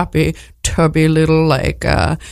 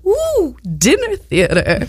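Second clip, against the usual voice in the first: delighted ooh; 9 semitones higher, slower than usual. Retold or imitated. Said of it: "And I'm so excited".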